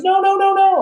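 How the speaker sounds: very high, sustained string of no's; acted alarm. Hospital woman stopping patient grabbing glass, alarmed.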